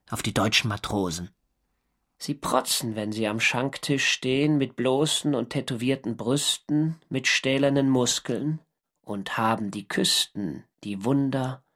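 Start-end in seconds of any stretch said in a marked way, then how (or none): 2.2-11.6 Gedichtvortrag. A narrator's reading. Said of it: "Ich verfasste ein Gedicht".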